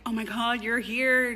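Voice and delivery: mock gushing welcome. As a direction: gush over her at the door. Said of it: "His mom is like".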